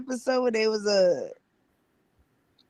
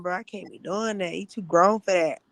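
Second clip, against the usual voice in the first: ordinary talk, nothing marked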